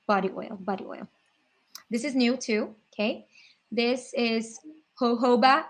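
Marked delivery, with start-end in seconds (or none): 5.0-5.7 jojoba said as "ho-HO-bah", h sounds; American pronunciation. Shown as a demonstration. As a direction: say jojoba with breathy H's, "hoh-HOH-bah". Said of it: "It sounds so funny when people say that".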